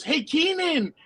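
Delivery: put-on high-pitched eager child; kid's voice. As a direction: imitate a kid pleading with a player. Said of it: "Some kid goes".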